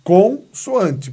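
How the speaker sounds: "consoante" split slowly into con and soante; palavra destacada. Shown as a demonstration. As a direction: punch the "con" in "consoante", then pause. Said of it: "olha o nome, consoante". ("consoante" separado em con-soante, devagar)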